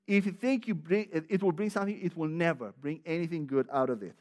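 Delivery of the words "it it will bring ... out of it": ordinary talk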